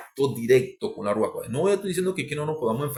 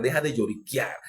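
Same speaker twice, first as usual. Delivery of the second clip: normal talking voice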